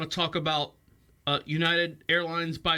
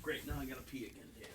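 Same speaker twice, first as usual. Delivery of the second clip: quiet aside; 4 semitones lower, 15 dB quieter than usual. Real, not acted. Off-mic aside.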